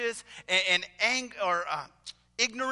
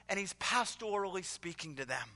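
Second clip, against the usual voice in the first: ordinary talk, nothing marked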